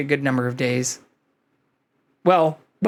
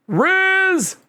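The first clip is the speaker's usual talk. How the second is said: squeaky shout; 4 dB louder than usual. Acted. High callout.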